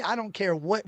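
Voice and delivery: normal talking voice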